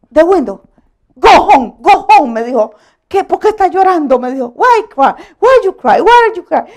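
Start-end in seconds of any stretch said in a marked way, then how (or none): 0.1-2.3 loud, raised-pitch imitation of a cop; retold police orders. Retold officer ordering her off. 3.1-4.2 loud, higher-pitched retold question; imitated baffled officer. Retold officer questions the crying driver. 4.5-6.6 loud, high, insistent questioning; mock an officer pressing for an answer; imitated baffled officer. Retold officer questions her in English.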